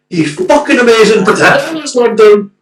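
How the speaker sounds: loud, high shout of praise; elated fickle fan. Retold or imitated. Imitating the same fan after the winning goal, elated shouting. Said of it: "of course the guy's going".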